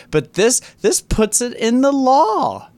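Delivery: climbing incredulous rant; rising outrage. Real, not acted. Ranting at the law, voice climbing high in outrage. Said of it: "rising outrage".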